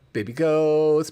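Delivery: excited. Real, not acted. Cooing over goat video.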